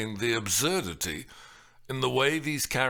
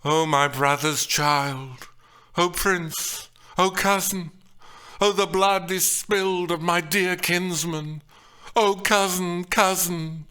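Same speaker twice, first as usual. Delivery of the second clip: lamenting Lady Capulet; 6 semitones higher, 5 dB louder than usual. Acted. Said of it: "Lady Capulet exclaims".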